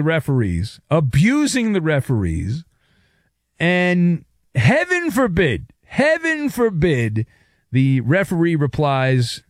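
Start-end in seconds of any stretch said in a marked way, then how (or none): 3.6-5.7 sarcastic. Acted. Sarcastic mock outrage. 5.8-7.2 sarcastic. Acted. Sarcastic mock outrage.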